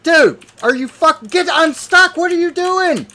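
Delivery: high, loud disbelief building to a screamed rage; real furious yelling. Real, not acted. Player screaming at his game after getting knocked down, furious. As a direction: yell in outrage, climbing into a high screech. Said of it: "That pissed me off so fucking bad".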